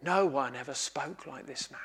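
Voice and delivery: soft, low, awed murmur; hushed awe. Acted. Officers telling the leaders what they heard, hushed and awed. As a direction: say it like you can't believe what you heard.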